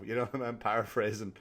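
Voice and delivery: ordinary talk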